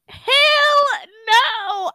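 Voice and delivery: high, loud Hell no; loud retold shout of refusal. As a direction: yell it back in disbelief. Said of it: "I said".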